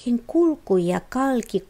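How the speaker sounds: usual speaking voice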